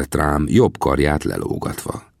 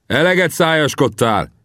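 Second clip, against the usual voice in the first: sharp, raised-voice challenge; shouted retort; 11 semitones higher than usual. A narrator's reading. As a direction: call out to silence a braggart. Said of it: "kiáltottam rá".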